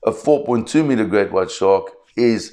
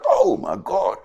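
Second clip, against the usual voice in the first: much higher, gushing with awe at the size; mock tourist amazement, pitched way up; amazed tourists; 10 semitones higher than usual. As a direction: gasp like a first-timer seeing a shark. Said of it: "everybody's like".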